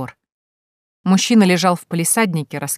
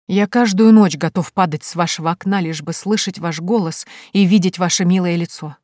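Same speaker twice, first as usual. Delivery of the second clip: plain reading voice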